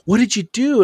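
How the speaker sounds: high-pitched retold outcry; aghast, exaggerated question; mock alarmed demand. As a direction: imitate a shocked bystander demanding an explanation. Bystanders accuse him in story.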